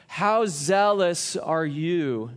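ordinary delivery

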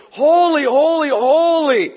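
high, booming cry of praise; loud proclamation. Acted. Heavenly praise as God comes, loud and high. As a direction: proclaim it big, stretching the opening words.

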